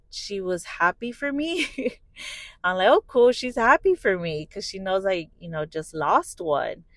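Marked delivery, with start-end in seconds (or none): 2.9-4.4 high, loud, pleased exclamation; bright-eyed mock excitement; retold delight. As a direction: act out being happily surprised, pitched up. Retelling her own pleased reaction.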